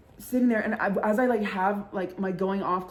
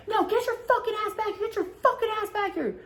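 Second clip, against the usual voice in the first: put-on much higher, shrieking girl voice; shrill friend yelling; 13 semitones higher than usual. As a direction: squeal it like a drunk friend pulling her back.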